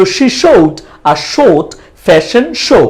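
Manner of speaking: very slow, separated words. Shown as a demonstration. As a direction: let each word be heard individually. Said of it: "প্রত্যেকটা word আপনারা individually শুনেন".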